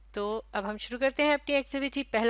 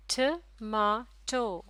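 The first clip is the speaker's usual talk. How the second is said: Tomato in three slow beats, ट, मा, टो; syllable by syllable; much slower than usual. Shown as a demonstration. Teacher asking learners to count syllables, slow and separated. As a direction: pause after each syllable of tomato. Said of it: "तीन syllable".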